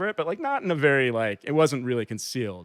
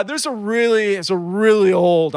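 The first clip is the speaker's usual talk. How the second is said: put-on "old" leaned on, loud and drawn out; stress on OLD; 6 semitones higher, 5 dB louder than usual. Man pleading for his worthless iPod, heavy stress on "old". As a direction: stretch and punch the word "old". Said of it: "trying to, like, just really emphasize the word old".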